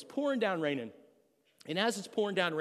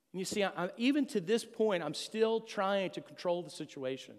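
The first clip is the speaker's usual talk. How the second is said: ordinary talk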